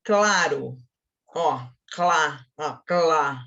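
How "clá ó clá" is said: "clá" as one slow syllable; demonstrated syllable. Shown as a demonstration. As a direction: show "clá" in a single emission. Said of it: "Viu onde fez o meu som?". (sílaba "clá" demonstrada devagar)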